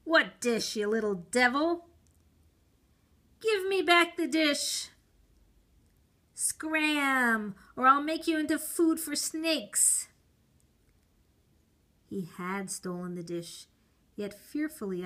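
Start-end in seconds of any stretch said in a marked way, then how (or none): 0.0-1.9 angry retort. A narrator's reading. Conjurer rebuffs the boy. 3.4-4.9 pleading demand. A narrator's reading. Boy pleads with conjurer. 6.3-10.1 loud, higher menacing threat; threatening. A narrator's reading. Conjurer threatening to feed the boy to snakes.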